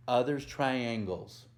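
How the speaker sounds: ordinary delivery, nothing marked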